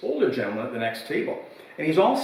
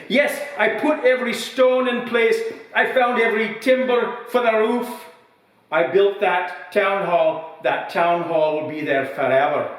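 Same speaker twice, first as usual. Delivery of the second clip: put-on old pub regular's voice pitched high, louder and proud; proud old man; 7 semitones higher, 3 dB louder than usual. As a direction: brag like a weathered old villager.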